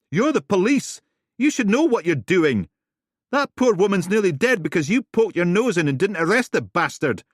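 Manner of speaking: very loud, high screaming accusation; furious shout. Acted. Helen screaming at Steele nose to nose, furious. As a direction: scream it in her face.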